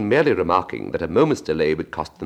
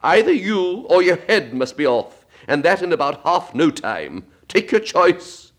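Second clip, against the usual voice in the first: much higher, louder, slow raging Queen; Queen shouting; 11 semitones higher, 5 dB louder, slower than usual. Acted. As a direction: hurl the ultimatum down at her. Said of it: "shouted the Queen".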